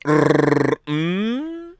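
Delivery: rolled trill sliding up into a long high hum. Shown as a demonstration. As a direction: roll a trill, then hold a rising "mmm". Voices friend's name as a noise.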